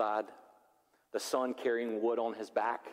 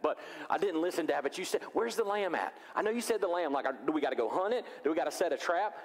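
put-on eager excited kid; 6 semitones higher than usual. Isaac voiced eager to dad.

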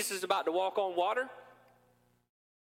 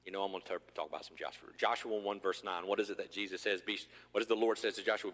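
ordinary talk, nothing marked

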